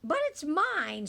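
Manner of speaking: snatch it back with a mild yell; mock scream. A narrator's reading. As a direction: Maisie screams at Horton, hammed-up. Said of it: "screamed the bird".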